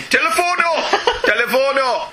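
mock Italian police shout of Telefono; shouting Telefono in a hammed-up Italian accent; Italian policeman accent. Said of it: "There's another accent to my repertoire. Italian".

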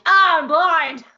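mock alarmed cry. Driver entering a brightly lit tunnel, mock panic. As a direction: cry out as if suddenly dazzled. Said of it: "you're just like".

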